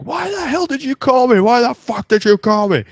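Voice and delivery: high, loud mock scream; mock screaming caller. Livid caller on the phone, high mock scream. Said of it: "screaming into the phone".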